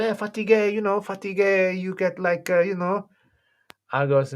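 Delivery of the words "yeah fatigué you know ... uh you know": high, put-on voice of the friend; mock explaining pal, held high throughout; imitating a friend. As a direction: explain it in a comic raised pitch. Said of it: "He goes".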